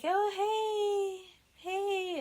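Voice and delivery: high, drawn-out put-on hello; mock flirty greeting. [name] approaching Sam for the challenge, mock coy.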